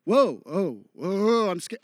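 mock scared reaction. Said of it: "three very different meanings".

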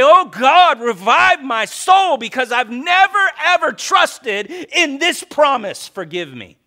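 loud, high, pleading cry to God; impassioned shouted prayer; fervent prayer cry. Acted. Voices a prayer of repentance.

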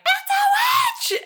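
high, drawn-out shriek; loud piercing accusation; Karen screaming. Retold or imitated. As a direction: scream it like a terrified little girl. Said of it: "it's Karen screaming".

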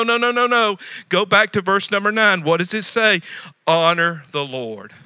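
usual speaking voice

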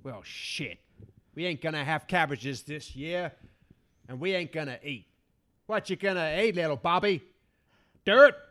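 grumpy cowboy farmer, low and gruff; drawling rural farmer, grumbling; old farmer voice. Acted. Plays old farmer figure.

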